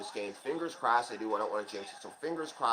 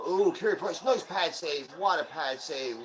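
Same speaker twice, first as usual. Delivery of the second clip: higher, louder praise of the save; excited save call; 5 semitones higher, 5 dB louder, slower than usual. Real, not acted. Fan cheering his goalie's pad save on stream, excited.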